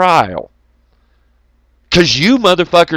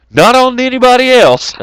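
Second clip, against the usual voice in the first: high and loud, hitting it hard; indignant yell, much higher and louder; emphatic shout; 10 semitones higher, 7 dB louder than usual. Real, not acted. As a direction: shout it out in protest. Heated rant about being blamed.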